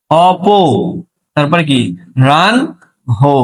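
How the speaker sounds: "অপ", "রান", "হো" spaced out with pauses; syllable by syllable. Shown as a demonstration. Teacher splits "অপরাহ্ন" into parts for the class. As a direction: break the word into "অপ", "রান", "হো". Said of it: "এটার উচ্চারণ অপরাহ্ন নাকি অপ".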